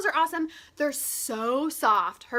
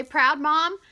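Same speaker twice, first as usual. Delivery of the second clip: normal talking voice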